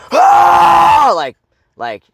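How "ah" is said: raw wordless caveman roar; full-throated primal yell. Shown as a demonstration. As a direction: belt out a primal scream. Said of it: "So just sound, like fucking caveman style".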